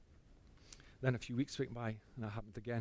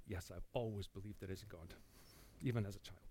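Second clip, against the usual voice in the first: hushed inner answer; 5 semitones lower, 15 dB quieter than usual. Retold or imitated.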